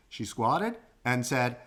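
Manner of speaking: ordinary delivery, nothing marked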